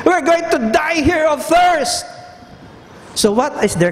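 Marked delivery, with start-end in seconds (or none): 0.1-2.1 high, slow whiny complaint; mocking grumbler. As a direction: whine like the thirsty Israelites. Preacher voicing grumbling people.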